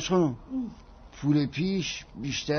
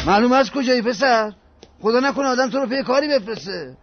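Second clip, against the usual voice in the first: put-on loud, higher scolding of the boy; 5 semitones higher, 7 dB louder than usual. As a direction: tell him off sharply. Boss scolds late errand boy. (سرزنش با صدای بلند)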